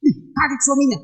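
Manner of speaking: usual speaking voice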